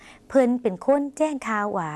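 very slow, clear repeat of เพิ่นเป็นคนแจ้งข่าวหวา; slow Lao demo. Shown as a demonstration. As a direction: repeat it very slowly for learners. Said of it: "ลองออกเสียงภาษาลาว". (อ่านซ้ำช้ามากให้ผู้ชมพูดตาม)

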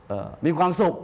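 ordinary talk

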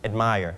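"admire" with a weak "ad" and a strong "mire"; stress on second syllable. Shown as a demonstration. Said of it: "straight ที่พยางค์ที่สองจะเป็น admire".

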